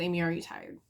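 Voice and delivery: asked quietly and gently; soft gentle question. Retold or imitated. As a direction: ease into it softly, like checking on a friend. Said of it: "so I asked her, I said".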